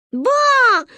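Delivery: put-on child calling. Gayane calls to her grandmother: high loud little-girl call. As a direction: tug at grandma for attention.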